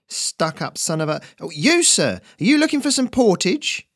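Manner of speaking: high, eager dockhand voice breaking off into fawning; voiced high street hustler; young Ferret. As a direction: snap from grumbling to sucking up to a customer.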